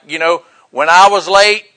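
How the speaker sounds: ordinary talk, nothing marked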